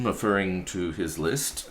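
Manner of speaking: usual speaking voice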